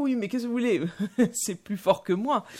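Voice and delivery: high, laughing mock excuse in a French voice; Jeffries' reply. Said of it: "il lui répond aussitôt".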